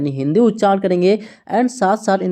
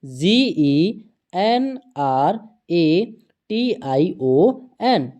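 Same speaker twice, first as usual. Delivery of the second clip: slow, evenly spaced letter-by-letter spelling; teacher dictating letters one at a time; much slower than usual. Shown as a demonstration. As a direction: read each letter out slowly for the class. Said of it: "क्या आता है".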